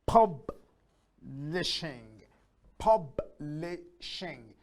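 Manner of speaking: PUBLISHING very slowly, pub, li, shing; long gaps inside publishing; split into syllables. Shown as a demonstration. Teacher counting publishing, split into syllables. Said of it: "that's three syllable".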